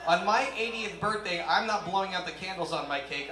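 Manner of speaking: usual speaking voice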